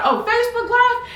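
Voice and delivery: high, loud mock realization; sudden dawning surprise. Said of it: "And I was like".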